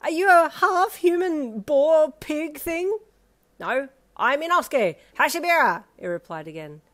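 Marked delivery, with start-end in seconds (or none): 0.0-3.0 high, loud, slow disbelief; a traveller gaping at a half-boar creature; panicked question. A narrator's reading. 3.6-5.8 high, loud proud announcement; a brash boar-masked boy naming himself; boastful boar boy. A narrator's reading. Repeats name proudly.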